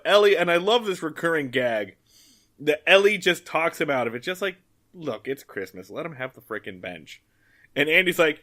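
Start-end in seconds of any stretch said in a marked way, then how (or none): 4.9-7.2 low, hushed appeal, imitating a peacemaker; soft persuasion. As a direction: talk him down quietly. Ellie gently talking Andy round.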